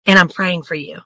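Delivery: ordinary talk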